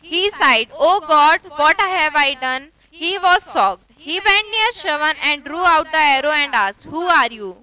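unhurried reading of the story text; slow story reading. A narrator's reading. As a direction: read it slowly, word by word, for learners. Slow English reading aloud.

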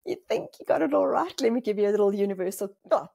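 lightly voiced teasing universe. Universe scolds the judging speaker, light teasing voice. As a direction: tease like a cosmic know-it-all. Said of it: "The universe goes".

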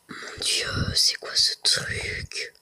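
slow awed whisper; hushed and slow. Real, not acted. Awestruck in the dark.

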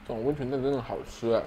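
talking while chewing; muffled by food in mouth; mouth full of egg. Real, not acted. Seen 0: Bites held egg at -3; cheeks look full while speaking early.

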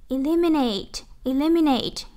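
"Eliminate" stressed on LIM, pitch falling after; second-syllable stress. Shown as a demonstration. Teacher drilling a word for students, stress on second syllable of "Eliminate". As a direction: put the weight on LIM in "Eliminate". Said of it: "nhấn âm hai".

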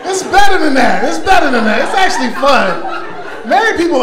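high, loud insisting; rally the married crowd, up and loud; pushing back at the audience's sad groan, loud and high; loud protest. Real, not acted.

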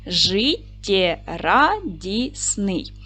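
very slow, syllable by syllable; split into syllables. Shown as a demonstration. Teacher dividing the word for pupils, slow syllables. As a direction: pull Життєрадісний apart into its syllables slowly. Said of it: "поділимо на склади".

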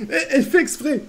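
high, loud laugh into the words; real incredulous laughing. Real, not acted. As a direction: protest through real laughter. Laughs at character's move. (rire incrédule, voix aiguë)

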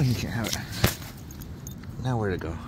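ordinary talk, nothing marked

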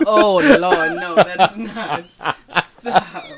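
loud, helpless laughing objection; laughing protest. Real, not acted. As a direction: protest through real laughter, dragging the words. Cracked up at husband's accent impression.

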